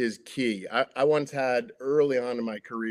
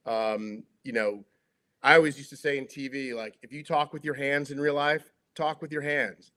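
normal talking voice

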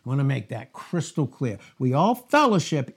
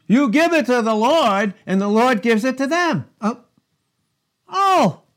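preaching scripture; 7 semitones higher, 5 dB louder than usual. Acted. Reads scripture at full force.

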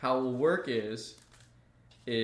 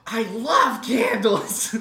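much higher and louder, slow gushing squeal; overly excited; 9 semitones higher, 11 dB louder, much slower than usual. Retold or imitated. A teen cashier gushing about candles, overly excited. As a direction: gasp then gush like a thrilled teen. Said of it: "just overly excited for candles".

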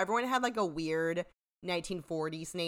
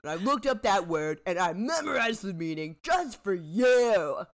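louder, dragging out the ending; mock indignant retort; 3 semitones higher, 6 dB louder than usual. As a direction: act out a wounded, storming-off comeback. Acted retort before storming out.

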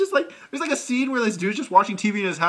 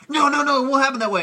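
high, loud mimicked wife; retold disbelief, voice raised; insistent protest; 10 semitones higher, 6 dB louder than usual. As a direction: protest urgently, pitched way up. Imitating wife protesting the ending.

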